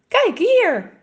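high, loud, eager call; excited call. Acted. Boy calls his mother and sister over, eager and loud. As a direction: shout it out, thrilled to show what you found.

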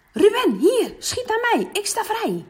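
high, loud call for the ball. Acted. Boy calling to Ruben for the ball, high and loud. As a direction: shout to get the pass.

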